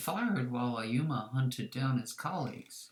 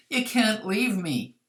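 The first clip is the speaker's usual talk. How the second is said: higher, louder plea not to be left; desperate plea; 6 semitones higher, 7 dB louder than usual. A narrator's reading. Posing as Hoki, begging allies to stay, loud plea. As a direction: beg them not to leave.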